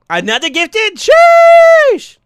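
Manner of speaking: very high loud drawn-out sheesh; a streamer's big hype yell; hype shout. Real, not acted. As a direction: blast it out in joy. Hype over gifted subs.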